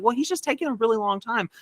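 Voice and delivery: put-on high, complaining child voice; imitated little girl. Little girl defends herself to a teenager, high imitated whine. Little girl's retort is acted out.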